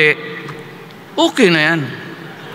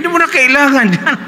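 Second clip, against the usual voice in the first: high, laughing mock advice; mock pep talk; 10 semitones higher than usual. Speaker mocking his old study habits, high and laughing. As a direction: cheer the minimum grade like a joke.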